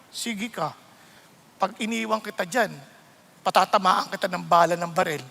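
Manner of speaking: voice of the Lord. Retold or imitated. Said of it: "papaalalahanan ka ng Lord".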